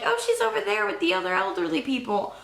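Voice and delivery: high, carefree sing-song reply; breezy mock answer. Boaters answering the boy, high carefree. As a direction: answer like nothing is wrong. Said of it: "they're like".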